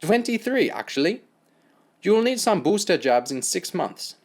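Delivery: German doctor, smug; German accent. Acted. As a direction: correct him smugly in German accent.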